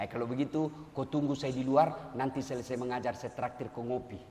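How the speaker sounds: soft, friendly correction to a late student; gentle teacher voice; not angry. Retold or imitated. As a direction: keep it calm and kind. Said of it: "Dia nggak marah".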